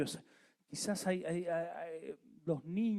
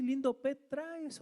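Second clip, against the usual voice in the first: burla ajena; 12 semitones higher, slower than usual. Retold or imitated. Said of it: "van a decir".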